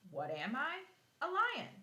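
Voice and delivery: put-on a lively read-aloud riddle for young kids. Teacher reading a lion riddle to her class, playful read-aloud.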